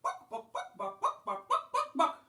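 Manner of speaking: very high, staccato clucks of "book"; clucking hen. A narrator's reading. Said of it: "She said".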